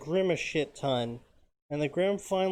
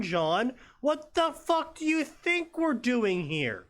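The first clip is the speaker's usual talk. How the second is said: mocking Sun voice; 5 semitones higher than usual. Narrator imitating Sun mocking John, sarcastic high voice. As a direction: sneer it out like a smart-aleck. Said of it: "That sounds exactly like him".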